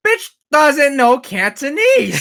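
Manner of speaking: loud, high, drawn-out comic discovery; hammed-up incredulous outburst; outraged realization. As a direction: blurt the retold revelation at full volume. Sudden realization about grandpa, retold.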